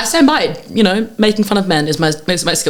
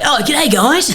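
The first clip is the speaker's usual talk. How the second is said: a hammed-up Aussie accent, pitched higher; 7 semitones higher, much slower than usual. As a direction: lay the Aussie accent on thick to charm the pub. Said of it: "real tune up the Aussie accent".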